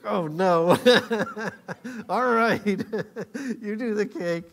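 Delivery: loud, laughing mock protest; laughing banter. Preacher retelling the cake banter, laughing. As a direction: give in loudly through laughter.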